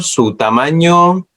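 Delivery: ordinary talk, nothing marked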